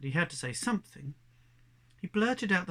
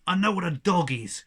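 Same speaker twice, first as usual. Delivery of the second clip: louder, sharp annoyed retort; snapped; 3 semitones higher, 10 dB louder than usual. Acted. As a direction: bite the line off at him. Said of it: "she snapped".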